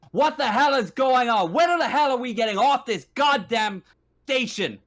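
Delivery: high, loud outraged yell; angry shouting. Acted. Stranded passenger yelling at station security, angry shouting. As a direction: holler it at security like a fed-up passenger. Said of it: "people are now shouting".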